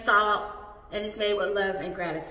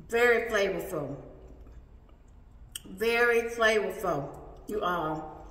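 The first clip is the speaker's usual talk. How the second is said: speaking with food in mouth; muffled words between chews; chewing taco while talking; 2 semitones lower than usual. Real, not acted. Seen 1: Holds bitten taco; lips closed, jaw working between words during speech.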